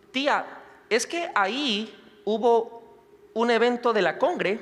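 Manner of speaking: put-on halting, high little-girl voice; Valentina. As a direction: imitate a toddler telling her aunt something. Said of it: "ni hablaba bien Valentina".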